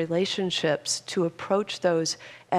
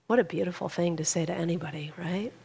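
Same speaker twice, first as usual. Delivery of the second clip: usual speaking voice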